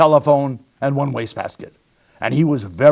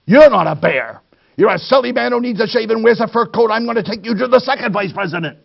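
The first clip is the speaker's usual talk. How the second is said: madder boss; 6 semitones higher, faster than usual. Acted. Third vice president scolds the bear, fast angry telling-off. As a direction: rattle it off in a rising temper. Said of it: "And he was even madder".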